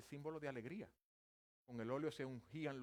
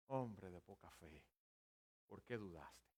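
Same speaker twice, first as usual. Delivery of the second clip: low, soft rebuke; gentle reproof; 8 semitones lower, 6 dB quieter, slower than usual. Acted. The Lord to rescued Pedro, quiet gentle reproof. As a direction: lower the voice and chide softly.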